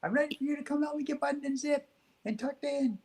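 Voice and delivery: put-on high, little boy's voice calling to mom; child calling out. Boy calling to his mom from his bedroom, high child's voice. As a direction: call to mom as a small boy. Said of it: "then try again".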